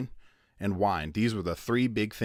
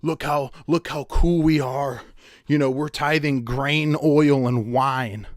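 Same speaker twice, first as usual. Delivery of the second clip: higher, louder, slow bragging voice; boastful Pharisees; 5 semitones higher, 8 dB louder, slower than usual. Retold or imitated. Preacher mocking the Pharisees bragging about their tithes, high, loud and slow. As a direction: brag like a smug show-off. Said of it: "So the Pharisees are like".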